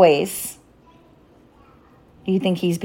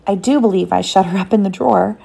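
plain reading voice

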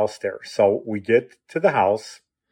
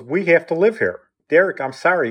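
higher-pitched, emphatic retold insistence; insistent; 6 semitones higher than usual. Father refusing to shelter son's friend, insistent. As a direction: retell a father firmly standing his ground.

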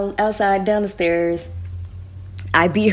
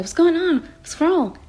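much higher, urgent roommate voice; alarmed roommate; 10 semitones higher than usual. Retold or imitated. Roommate pulls her into room, much higher alarmed question. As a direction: voice the panicked roommate high. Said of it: "She was like".

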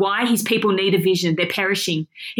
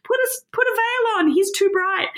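dazzled plea; 10 semitones higher than usual. Retold or imitated. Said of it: "It's this Moses moment".

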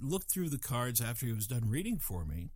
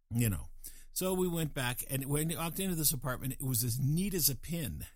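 ordinary talk

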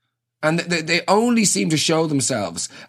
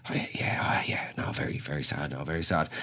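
hushed, solemn whispered condolences; mock funeral whisper; 10 semitones lower, 10 dB quieter than usual. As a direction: whisper it like you're at a wake. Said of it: "you have to whisper 'cause you're at a funeral".

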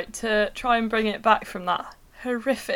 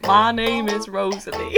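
put-on Southern American accent. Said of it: "read it in a Southern".

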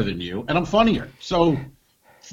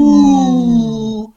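loud, high gasp into an ooh; imitated audience gasp; 9 semitones higher, 10 dB louder than usual. Mimicking the crowd after a weak heckle, loud gasping ooh. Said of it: "and the audience goes".

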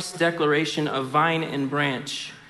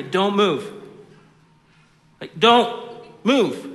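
firm, louder parent warning; warning a small child; 4 semitones higher, 5 dB louder, slower than usual. Retold or imitated. Parent in a parking lot telling toddler don't move. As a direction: hold the kid in place with your voice. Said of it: "I would start leaning in and be".

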